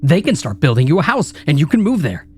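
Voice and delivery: from a sneering taunt to a high screaming rant; building to a scream. Acted. Inner critic rant builds to screaming.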